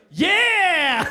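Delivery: high, loud, gleeful yes; eager yell. Retold or imitated. Client proudly confirming the butts joke, excited yell. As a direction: blurt it out, thrilled.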